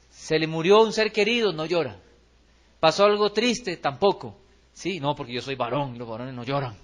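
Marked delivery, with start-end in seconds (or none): 4.7-6.8 a hint of proud tough guy, a bit lower and softer; stoic macho man, lightly voiced. Preacher mocking a congregant who says men don't cry, in a softer, lower macho voice.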